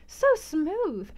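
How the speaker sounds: put-on high, gushing young lady; gushing Louisa. A narrator's reading. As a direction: coo it over him, high and adoring. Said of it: "cried Louisa".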